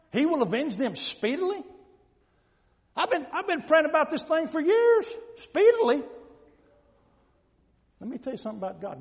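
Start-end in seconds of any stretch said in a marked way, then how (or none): 0.1-1.6 rising, disbelieving echo of the promise; incredulous question. Acted. Worshipper doubting God will avenge speedily, incredulous. 3.0-6.0 raised, incredulous protest; acted exasperation. Believer praying about this thing for years, exasperated.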